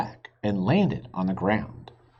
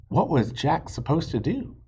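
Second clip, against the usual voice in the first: usual speaking voice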